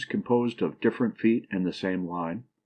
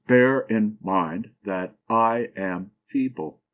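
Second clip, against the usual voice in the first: slow scansion, stressing Bear, mind, I, fee- in "feeble"; trochaic stress; slower than usual. Shown as a demonstration. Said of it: "first syllable accented and the second not".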